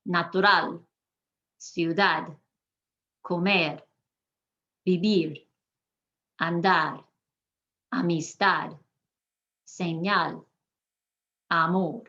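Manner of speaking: word list with "comer", "vivir" landing on the last syllable; last syllable stressed. Shown as a demonstration. Teacher giving examples of the rule, "Natural" stressed on its end. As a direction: stress the final syllable, as in "ciudad" and "amor". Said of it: "emphasis naturally falls on the last".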